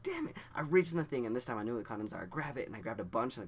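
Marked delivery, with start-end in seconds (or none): 0.0-0.4 high-pitched exasperated curse; mock frustration. Flustered teen after ripping it open, high mock cursing. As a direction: squeak it out in frustration.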